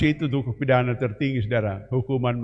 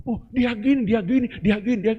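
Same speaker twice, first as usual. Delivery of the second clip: mock gossip; 7 semitones higher than usual. Mocking gossiping church members.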